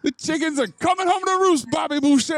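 put-on high-pitched comic Cajun accent; Mama Boucher. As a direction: call it out as a Cajun mother. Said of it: "Cajun accent as Mama Boucher".

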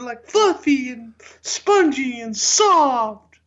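high, drawn-out hammed-up whine; whining complainer. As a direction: moan mock-pitifully, stretching key words. Hammed-up complaint about hard rolls.

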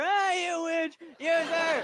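hammed-up outburst; mock shout. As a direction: yell it at the girl in jest. Mock insult in joke.

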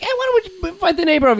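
high, quick girlfriend impression; chirpy high-pitched girlfriend. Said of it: "your girlfriend's like".

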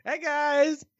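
chirpy mock hello; squeaky mock greeting; playful greeting voice. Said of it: "Hey Guy is really hard not to do that".

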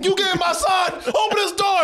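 high-pitched mock shouting; imitated angry demand. Mother at a stranger's door, acted furious shout. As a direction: act out an enraged mother demanding entry.

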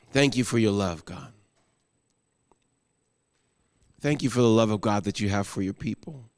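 low, soft, reverent prayer voice; closing prayer. Acted. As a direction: pastor praying over the congregation, hushed and low. Pastor leads closing prayer.

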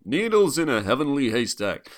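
mock old-film American narrator; voiceover narrator. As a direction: intone the title-like line as a grand voiceover. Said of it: "the very first scene after the".

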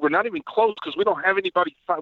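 ordinary talk, nothing marked

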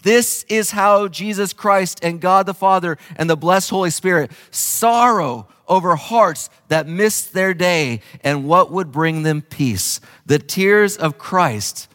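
preaching the quote with fervour; impassioned preaching. Acted. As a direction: lift it up with passion. Quoting Hughes, preaching.

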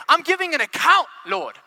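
high, loud announcement to God; confident declaration. Acted. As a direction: proclaim it up high, dropping on Lord. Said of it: "how would you say that?".

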